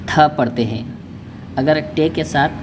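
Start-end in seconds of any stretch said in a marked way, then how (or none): 0.1-0.3 थ said as a breathy aspirated th. Shown as a demonstration. Teacher to Urdu learners, aspirated थ clearly sounded. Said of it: "तो उसे".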